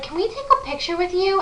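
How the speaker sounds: high-pitched imitation of excited girls; retold eager request. Girls randomly approach for a picture, high eager voice. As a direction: raise the pitch, asking keenly.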